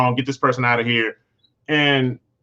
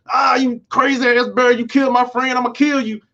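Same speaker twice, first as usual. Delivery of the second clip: high, angry outburst at the bear that killed his friend; furious yell at a bear; 9 semitones higher than usual. Retold or imitated. Acting out a man yelling at the bear that killed his friend, high and angry. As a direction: lash out in grief and rage.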